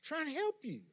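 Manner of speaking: very high, strained mock plea; exasperated pleading. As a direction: plead with a stubborn patient, voice pushed way up. Acting out exasperated helpers' plea.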